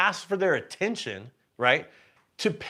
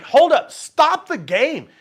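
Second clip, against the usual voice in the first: much higher and louder, slowed demand; demanding stop; 10 semitones higher, 8 dB louder, slower than usual. Retold or imitated. Player demanding dealers halt the game, loud slow shout. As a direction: shout it out slowly. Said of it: "And I'm like".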